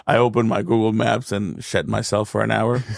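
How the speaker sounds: usual speaking voice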